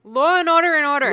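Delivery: loud, high insistent repeat; mock-insistent correction; emphatic repeat. Real, not acted. Insisting on the silly phrase.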